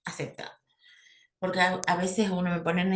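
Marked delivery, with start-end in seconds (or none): 0.0-0.5 whispered aside. Real, not acted. Indeterminado o indeterminante.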